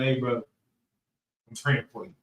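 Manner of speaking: slow, soft, sincere promise. Retold or imitated. Speaker to a struggling brother, slow and sincere. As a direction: say it slowly like you truly mean it.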